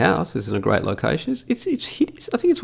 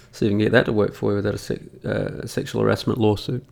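whispered aside; 5 semitones lower, 5 dB quieter, faster than usual. Real, not acted. Joking aside about lawsuit.

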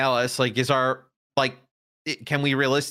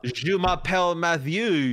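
mock French accent; 5 semitones higher, slower than usual.

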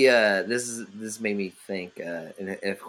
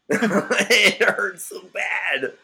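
laughing, drawn-out high wail; comic pained cry; mock anguish; 9 semitones higher, 5 dB louder, much slower than usual. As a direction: ham up the heartbreak through laughter.

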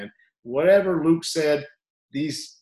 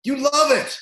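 coach yelling; 11 semitones higher, 5 dB louder than usual. Retold or imitated. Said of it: "And I go".

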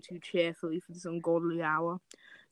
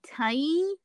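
cheerful offer; 8 semitones higher than usual. Acted. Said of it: "I don't know if you understand it through the voice".

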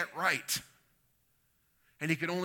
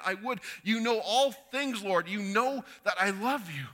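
emphatic declaration; 5 semitones higher, 3 dB louder than usual. Acted. Peter pleading his love to Jesus, emphatic. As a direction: pour out the confession with urgency. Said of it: "he just emphatically declares".

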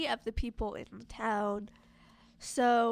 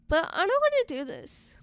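higher, louder mock whine of reluctance; reluctant protest; 9 semitones higher, 6 dB louder than usual. Mob member balking on the way to Edward's house, reluctant protest. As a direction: play up not wanting to join in.

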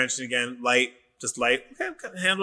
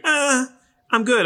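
carefree; 13 semitones higher, 4 dB louder, slower than usual. Retold or imitated. Mocks his past carefree self.

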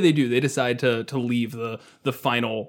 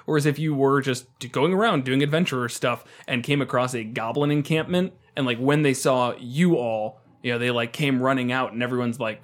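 usual speaking voice

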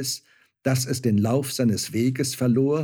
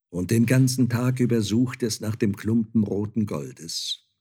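ordinary delivery

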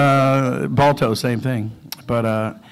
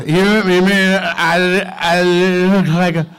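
slow, higher, louder disbelief; stunned old actor; 6 semitones higher, 6 dB louder, slower than usual. Retold or imitated. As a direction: drag it out in shock. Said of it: "and he goes".

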